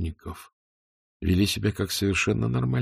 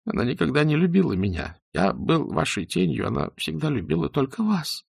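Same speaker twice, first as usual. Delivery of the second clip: higher, pleading through tears; tearful confession; 7 semitones higher than usual. Acted. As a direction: confess it, close to crying. Weeping doctor's confession. (сквозь слёзы, с мольбой)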